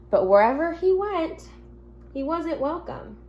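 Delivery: gentle storybook reading to the class; reading to children. Acted. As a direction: read it like a teacher at story time.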